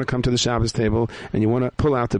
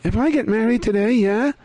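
high character voice; 11 semitones higher than usual. Acted. Character speaking in the story.

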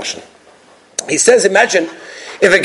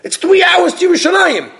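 high shouted reply; a local yelling at a clueless passenger; exasperated shout; 13 semitones higher than usual. Retold or imitated. Telling him he's lost.

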